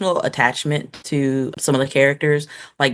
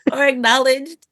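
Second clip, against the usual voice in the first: higher, mocking repeat of "Or acknowledged."; playful echo; 8 semitones higher than usual. Podcaster echoing her co-host, higher and playful. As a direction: echo it back with a lilt.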